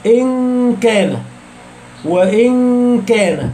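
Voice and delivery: "إن كان" slow, the n stretched as a hum toward the k; hidden noun, ikhfa. Shown as a demonstration. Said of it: "تحس النون بتتسحب".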